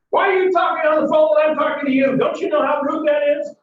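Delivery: high, fast shouted scolding; angry blasting. Acted. Driver scolding a passenger for being on the phone, angry blasting. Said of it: "and he blasts you".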